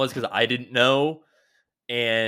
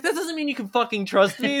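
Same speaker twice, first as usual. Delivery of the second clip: high-pitched retold objection; mock indignation; 12 semitones higher than usual. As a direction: play an exasperated rebuttal, pitched up. Retold argument over trust.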